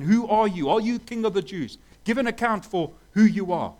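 accusing rulers questioning Jesus; demanding interrogation. Retold or imitated. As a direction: demand an answer from the prisoner.

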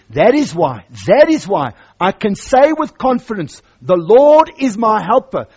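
rising loud proclamation; full-force sermon read; preaching loud. Acted. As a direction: build it up with confidence.

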